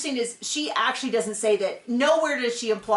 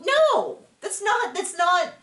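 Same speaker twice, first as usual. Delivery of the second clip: much higher, rising into a frustrated "No"; exasperated protest; 7 semitones higher than usual. Retold or imitated. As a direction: cut in, fed up and incredulous. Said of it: "You go".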